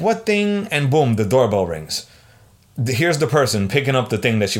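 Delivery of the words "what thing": baffled. Retold or imitated. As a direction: ask it like the news just landed on you. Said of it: "I'm like".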